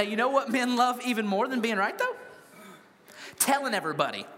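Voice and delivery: ordinary delivery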